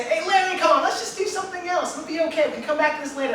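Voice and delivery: put-on scared teen begging his friend to quit, high pleading. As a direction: beg him to stop, voice up and pressing. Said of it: "I plead with him".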